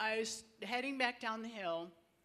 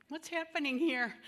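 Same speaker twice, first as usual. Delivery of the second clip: amazed wonder; 6 semitones higher, 6 dB louder than usual. Retold or imitated. Wondering at the butterfly.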